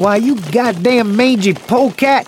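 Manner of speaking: high-pitched sputtering outrage; indignant cussing. Acted. Old man teased about his age, indignant. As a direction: cuss out a friend who teased you.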